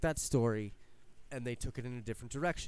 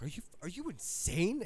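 higher, put-on voice of disbelief; incredulous guard; 7 semitones higher than usual. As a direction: balk at the crazy order. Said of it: "And they're like".

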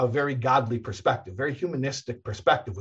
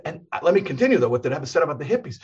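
ordinary talk, nothing marked

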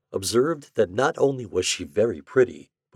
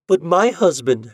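high, louder protest; 10 semitones higher, 6 dB louder than usual. A narrator's reading. Widow defending her husband to the doctor, protesting. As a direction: object at once, pitch raised. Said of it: "she protested".

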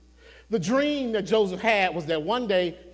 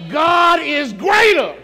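God is greater, shouted high and slow; shouted climax; 7 semitones higher, 9 dB louder, slower than usual. Acted. Preacher shouting God is greater to the congregation. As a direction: hit greater with full force.

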